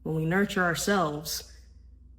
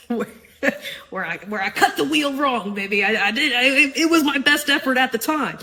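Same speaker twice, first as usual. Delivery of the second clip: laughing, louder and higher, flustered; 5 semitones higher, 5 dB louder than usual. Real, not acted. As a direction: laugh through the words. Reliving parking moment, laughing.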